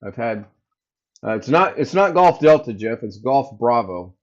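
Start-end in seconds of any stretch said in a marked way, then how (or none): none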